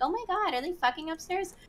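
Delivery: high, softened imitation of her gasping gossip; mock scandalized quote. Quoting what she said in clip.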